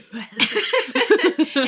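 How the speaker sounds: high, loud burst of laughter. Real, not acted. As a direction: break out laughing hard at yourself. Cough interrupts, she bursts out laughing.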